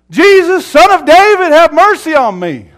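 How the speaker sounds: very high, very loud sustained cry; screamed plea. Retold or imitated. Blind beggar calling to Jesus passing by, screamed plea. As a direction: scream for mercy over a crowd, high throughout. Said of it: "he screamed all the louder".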